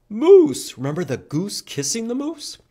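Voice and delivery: ordinary delivery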